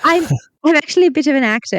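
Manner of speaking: lightly voiced pompous auditor. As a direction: [name] playing the auditor in disguise, lightly put-on. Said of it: "This is my auditor voice now".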